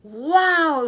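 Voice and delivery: high, loud, drawn-out wow; amazed. Acted. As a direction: marvel at an unbelievable catch. Girl sees a television come up.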